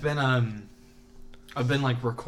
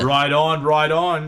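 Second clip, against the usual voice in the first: mock Australian accent; 3 semitones higher, 9 dB louder, slower than usual. Mock Australian banter continues.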